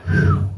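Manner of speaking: high, exaggerated exhale of relief; relieved whew. Comic finally feeling at home, hammed-up relief. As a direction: let out a big mock-relieved whew.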